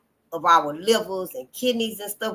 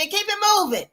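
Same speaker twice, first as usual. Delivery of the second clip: high breezy; 10 semitones higher, faster than usual. Retold or imitated.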